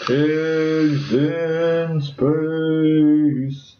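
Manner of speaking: very slow stretched announcer voice; drawn-out announcer. Pretend. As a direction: stretch every word like a trailer voice.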